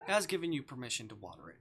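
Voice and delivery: ordinary delivery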